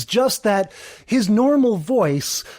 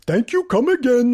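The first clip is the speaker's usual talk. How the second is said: put-on Apu Indian accent; 5 semitones higher than usual. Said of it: "He's not doing an Apu accent".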